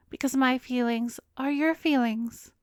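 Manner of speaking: usual speaking voice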